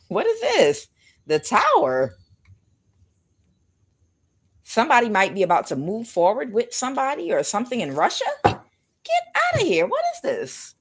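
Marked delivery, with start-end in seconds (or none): 0.1-0.9 surprised. Real, not acted. Startled by a tarot card. 8.4-10.7 much higher, slow, amazed cry; stunned by what the cards show; astonished disbelief. Real, not acted. Reader sees the tower card, astonished high disbelief.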